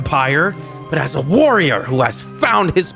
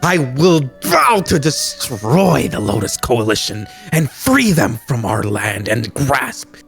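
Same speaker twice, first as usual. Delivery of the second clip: hammed-up impassioned oath; acted fiery hero's declaration; righteous fury; 3 semitones lower than usual. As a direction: punch the key words, climb at the end. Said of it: "his voice full of righteous fury".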